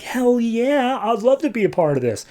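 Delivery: thrilled to join the chase, fast and high; excited woman. Retold or imitated. Woman in the convertible agreeing to help, excited.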